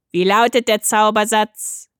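loud squawky gatekeeper voice; squawking voice. A narrator's reading. Voice at the peephole demands the magic phrase, squawking. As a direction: squawk it through a little hatch. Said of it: "quäkte eine Stimme hindurch".

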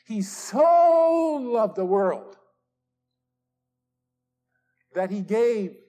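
very slow, punching the word "so"; stress on so. Shown as a demonstration. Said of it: "biggest, littlest word ... this word "so".

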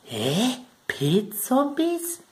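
high, slow incredulous question; mock the confused viewer asking back; drawn-out baffled "Pilz-Zombies?" way up high; puzzled disbelief. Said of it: "Jetzt werdet ihr sagen".

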